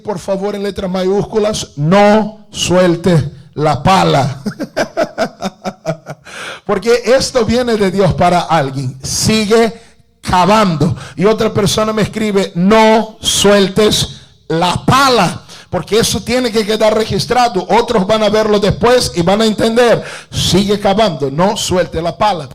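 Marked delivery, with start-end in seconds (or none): 1.8-4.4 slow, loud preacher's command; loud, drawn-out dictation of a slogan; emphatic slogan. Real, not acted. Dictates slogan for congregation to write. 4.5-6.6 long run of chuckling; preacher's laugh after a punchy line. Real, not acted. Laughs after dictating slogan. 6.7-22.5 loud, raised preacher's voice; loud exhortation, hammering the slogans; full-force preaching. Real, not acted.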